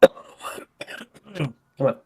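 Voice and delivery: ordinary talk, nothing marked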